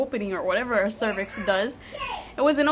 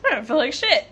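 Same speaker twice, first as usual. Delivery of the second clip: laughing. Real, not acted. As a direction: laugh through the words, then burst out.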